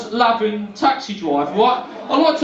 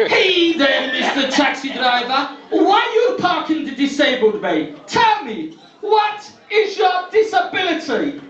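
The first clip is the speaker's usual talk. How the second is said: higher, slower mocking warden voice; shit impression of traffic warden; 5 semitones higher, slower than usual. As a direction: send up the officious warden. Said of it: "the same shit impressions".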